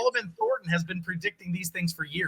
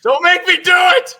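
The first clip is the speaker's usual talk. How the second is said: much higher and louder playful threat; mock threat; 11 semitones higher, 11 dB louder than usual. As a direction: bark it out as a joking warning.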